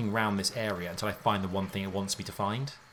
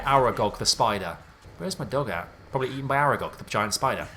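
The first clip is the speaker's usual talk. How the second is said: ordinary talk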